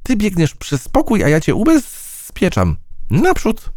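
high, eager fly battle cry; rally the mouse up high: charge. A narrator's reading. Fly urging the mouse to tease the cat, high.

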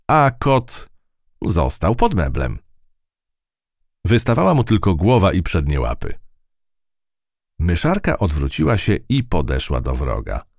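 usual speaking voice

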